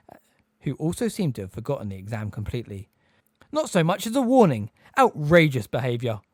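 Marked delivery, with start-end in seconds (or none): 3.5-6.2 high, loud indignant protest; outraged. A narrator's reading. Examiner condemning the attack, outraged and loud. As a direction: sputter with old-fashioned outrage.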